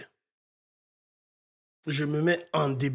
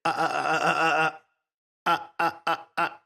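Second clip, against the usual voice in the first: rapid-fire "ah" repeats, then more spaced ones; imitated stutter effect. Shown as a demonstration. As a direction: mimic a looping stuttered syllable "ah". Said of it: "Vous pouvez faire". (« ah » répétés comme un bégaiement)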